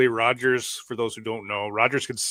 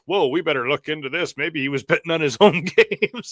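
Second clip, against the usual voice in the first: higher-pitched mocking outrage, breaking into laughter; mock alarm; 5 semitones higher, 4 dB louder than usual. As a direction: play the scandalized official, mockingly. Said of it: "and the N C A A goes".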